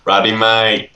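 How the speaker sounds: badly imitated Aussie accent; amateur go at an Australian accent; attempted Australian accent. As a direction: copy a broad Australian "mite" for MATE. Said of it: "work on your Australian accent".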